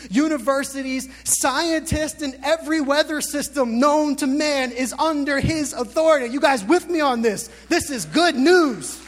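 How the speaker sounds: fervent pulpit crescendo; preaching at full force. Acted. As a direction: build the list toward a big climax.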